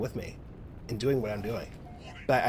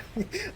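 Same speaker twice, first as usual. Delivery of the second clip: high laugh; 3 dB quieter than usual. Real, not acted. Laughing while ranting.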